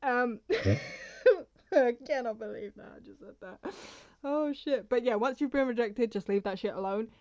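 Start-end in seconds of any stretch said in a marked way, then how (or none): 0.4-1.4 embarrassed laugh. Real, not acted. Laughs at her own remark.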